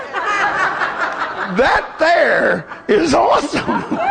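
slow, high words dissolving into laughter; laughing. Real, not acted. Preacher laughing about preferring the war zone, slow laughing. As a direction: stretch it out, cracking up.

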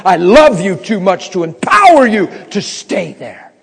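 loud, high, full-force sermon delivery; impassioned preaching. Real, not acted. Preacher telling how God confronted his wife, impassioned full-force preaching. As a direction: drive it home at full preaching volume.

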